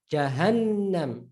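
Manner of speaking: Jahannam slowly, holding the N long; doubled N. Shown as a demonstration. Teacher showing Arabic pronunciation of Jahannam, doubled N. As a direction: stretch the N in Jahannam. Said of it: "Look at the difference".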